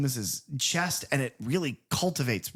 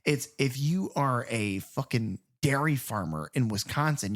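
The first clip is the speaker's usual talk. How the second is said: ordinary delivery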